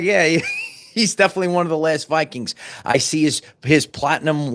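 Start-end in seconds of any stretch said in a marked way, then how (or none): 0.0-2.5 quick words broken by real laughter; laughing. Real, not acted. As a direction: say it through a laugh, amused. Laughing at the tall Viking.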